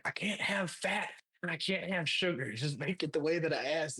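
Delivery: a hint of a cranky customer; demanding old man. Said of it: "He's like".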